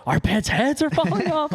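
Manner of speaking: high, whiny quoted catchphrase; mock whine. Roommate blurting the movie line at a bar, high and whiny. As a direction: pitch it up out of nowhere.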